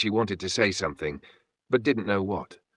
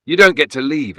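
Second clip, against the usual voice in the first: louder, higher howl, almost non-human; 6 semitones higher, 7 dB louder than usual. A narrator's reading. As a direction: howl it at them like a trapped spirit. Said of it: "it howled".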